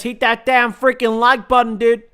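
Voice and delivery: loud, high-pitched hammed-up American; American accent. Streamer quoting a viewer's like-button plea, exaggerated American accent. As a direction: belt it out in a put-on American accent. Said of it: "I'm so good at American accents".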